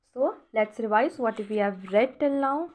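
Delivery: usual speaking voice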